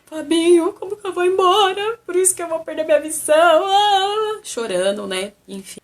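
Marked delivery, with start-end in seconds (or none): 0.0-4.4 high, loud, tearful wail; crying to her brother on the phone, wailing high. Retold or imitated. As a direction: sob it out to your brother. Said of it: "Fiquei chorando as pitangas pro meu irmão".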